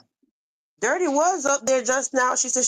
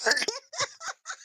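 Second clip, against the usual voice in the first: high squealing laugh; 14 semitones higher, 8 dB quieter than usual. Real, not acted. Laughing at own impression.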